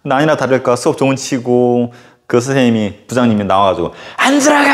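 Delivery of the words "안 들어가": angry shout. Retold or imitated. Head teacher yelling at students.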